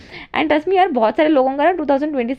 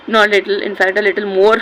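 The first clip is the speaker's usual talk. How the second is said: ordinary talk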